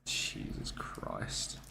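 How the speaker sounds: slow breathy whisper; slow whispered Jesus Christ; whispered dread. Real, not acted. Dreading the wax strips.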